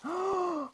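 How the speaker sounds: sharp high gasp of delight; excited gasp. Real, not acted. Vlogger unwrapping her prize, a high excited gasp. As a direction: gasp at the surprise inside.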